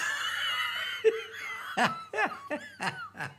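high laugh at own punchline. Real, not acted. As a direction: crack up after the joke.

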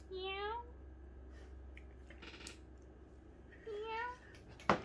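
very high, soft meows; kitten meows. Pretend. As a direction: mew like a tiny kitten. Kitten voiced in story.

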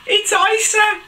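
very high excited exclamation; delighted discovery. Acted. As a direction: exclaim as if spotting a friend. Heroine recognises singing friend.

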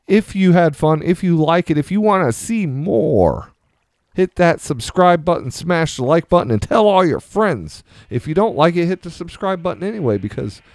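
high, bright YouTuber sign-off; hyped outro. Acted. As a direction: pump up the channel outro.

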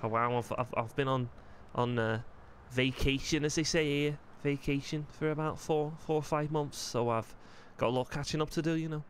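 put-on light Scouse accent as the character Joel; easygoing Liverpool lad in a Scouse voice. Joel chatting with Cyrus, relaxed Scouse accent.

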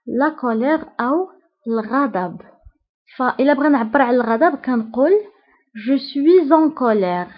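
normal talking voice

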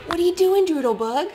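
normal talking voice

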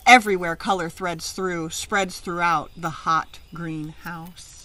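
lively picture-book reading; read-aloud story. Acted. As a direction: read it out to a class of children.

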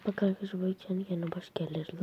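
hushed whisper to viewers. Real, not acted. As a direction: keep it a breathy whisper, not to wake anyone. Night stream, viewers addressed whispering. (fısıltıyla)

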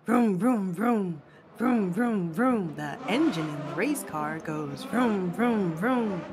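ordinary delivery, nothing marked

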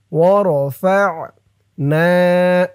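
slow "ورفعنا" with the final "na" held long; one-alif stretch. Shown as a demonstration. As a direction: draw out the end of "ورفعنا". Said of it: "না এক আলিফ টান". ("ورفعنا"-র শেষের না এক আলিফ টেনে)

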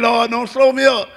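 ordinary delivery

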